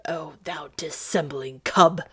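husky, contemptuous accusation; bitter exclamation. Acted. Duke to the page he thinks false, bitter exclamation. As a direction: spit the insult under the breath. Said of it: "the Duke exclaimed".

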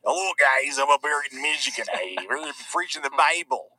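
playful put-on Michigan accent. Preacher greeting the viewers from up north, Michigan accent. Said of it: "What about the Michigan accent?".

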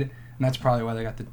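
ordinary talk

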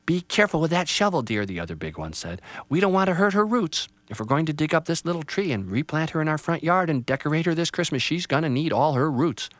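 a mom cautioning her husband; lightly voiced mother. Mother warns dad about the roots, lightly voiced.

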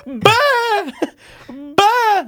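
high squealing laugh bursts; laughing shriek. Real, not acted. Laughing hard at his friend's joke, high bursts.

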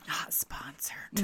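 slow whispered aside; whispering. Acted. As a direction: whisper it like a secret. Aside joke about soda brand.

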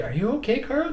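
high, worried "are you okay" voice; mock-concerned bystander checking on him; concerned onlooker. People asking the slumped troll.

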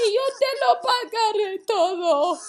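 high, loud desperate begging with laughing sobs; pleading fellow servant. Acted. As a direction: plead for time, voice cracking between laugh and cry. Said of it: "le rogaba, diciendo".

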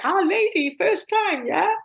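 put-on high, teasing Indonesian-accented singsong; grinning Indonesian steward. Indonesian steward greets the new girl, grinning high-pitched accent. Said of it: "grinning from ear to ear".

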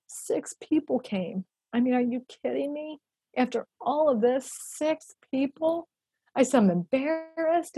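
retold tearful voice. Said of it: "my voice was broken".

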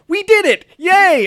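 high, loud hammed-up cheering; mock celebration. Co-host imitating the jumping double high five, mock excited cheer. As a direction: cheer like an overjoyed movie winner.

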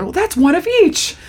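high-pitched retold delight; excited. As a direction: imitate a new dad's excitement, pitch lifted. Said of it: "So they're all excited".

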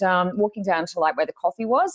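normal talking voice

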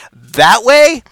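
high, loud incredulous "That way?"; bewildered question; buddy doubting the direction, incredulous. Retold or imitated. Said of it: "my buddy goes".

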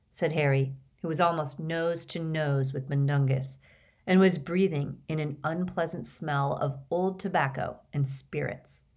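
usual speaking voice

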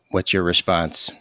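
ordinary talk

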